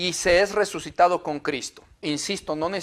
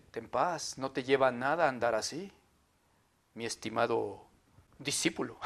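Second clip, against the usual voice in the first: much softer and lower, calm guru tone; serene monk; 6 semitones lower, 13 dB quieter than usual. Retold or imitated. The monk calming his rushed old friend, hushed and low. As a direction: soothe the hurried friend like a guru. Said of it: "te dice".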